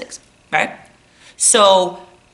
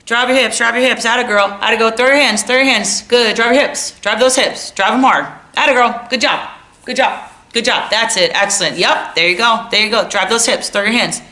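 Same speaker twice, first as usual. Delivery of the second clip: higher, rapid-fire coach patter; repeat private lesson coach; 4 semitones higher, 4 dB louder than usual. Acted. Coach endlessly repeating cues to an athlete, nonstop hype. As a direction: nonstop hyped-up drill chatter, loud at first. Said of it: "sounds like this".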